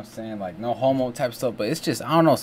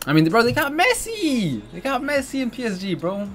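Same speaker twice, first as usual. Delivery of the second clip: pitched way up, hyped about a star player; excited; 10 semitones higher than usual. Real, not acted. Vlogger hyped that PSG has Messi, high excited. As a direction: shout it like big news to a friend.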